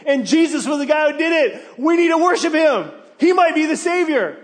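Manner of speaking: mock urging the parents to celebrate; exasperated excitement. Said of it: "And you're like".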